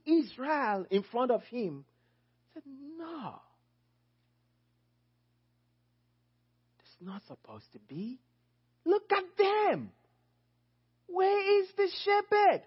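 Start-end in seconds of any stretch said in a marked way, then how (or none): 3.0-8.2 very soft, acted dismay; hushed disbelief. Acting Christ's dismay at the crowds. 8.8-12.6 acted distressed outcry. Acting Christ seeing shepherdless crowds.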